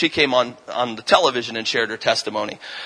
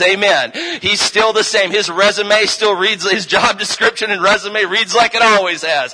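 preaching at full force; 4 semitones higher than usual. Acted. Preacher builds to a full-force proclamation.

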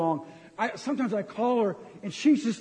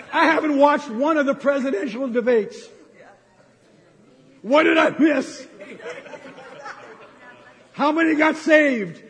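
loud, higher, laughing preacher; preaching at full force; 4 semitones higher, 4 dB louder than usual. Real, not acted. Pastor teasing his church about the debates, loud and laughing. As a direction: hammer home the point with a laugh.

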